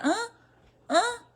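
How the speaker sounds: high, rising questioning "huh?"; child not hearing. Acted. Child used to shouting fails to hear, puzzled "huh?". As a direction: imitate a child who missed what was said. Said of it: "a criança fica".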